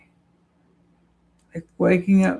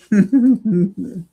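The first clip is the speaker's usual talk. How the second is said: real laugh; 2 semitones higher, 4 dB louder than usual. Real, not acted. Laughs at own confusion.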